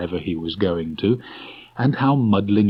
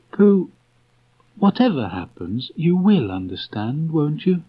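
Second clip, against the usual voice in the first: put-on high, halting, earnest boy; mean every broken word; 8 semitones higher than usual. A narrator's reading. Christopher Robin holding Pooh's paw, earnest halting plea.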